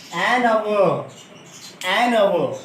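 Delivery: an hour with no h, linked as an-our; silent h. Shown as a demonstration. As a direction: say an hour without any h. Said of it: "silent है". (बिना ह के, an hour)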